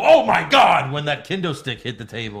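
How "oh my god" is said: loud, high commentator outburst; Tom Phillips. Retold or imitated. Host mimics a commentator overreacting to a hit, shouting. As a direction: shout it like a shocked play-by-play caller. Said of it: "Why did Tom Phillips go".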